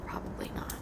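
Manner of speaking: barely voiced whisper; hushed second thoughts. Real, not acted. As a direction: whisper it as if to yourself. Second thoughts about oversharing.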